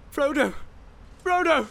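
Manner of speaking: very high, desperate call; high-pitched searching cry. A narrator's reading. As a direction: call after someone who has fled. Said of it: "he called".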